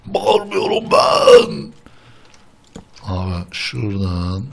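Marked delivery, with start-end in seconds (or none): 0.1-1.7 high, slow, drawn-out yell; mock shouted denial. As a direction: yell the denial, stretching every word.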